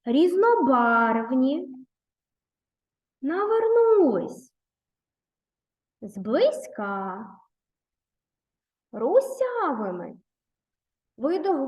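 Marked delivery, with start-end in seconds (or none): 0.1-10.2 Різнобарвні, Навернулись, Зблизька, Русявими read slowly with clear word stress; careful stress. Shown as a demonstration. Teacher models correct stress for pupils, slow deliberate reading. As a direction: say each word slowly, leaning on the stressed syllable. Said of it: "прочитаємо слова, правильно поставивши наголос".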